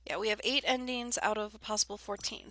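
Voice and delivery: ordinary talk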